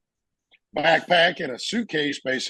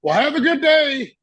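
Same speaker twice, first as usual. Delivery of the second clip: cheery high farewell; 12 semitones higher, 5 dB louder than usual. Retold or imitated.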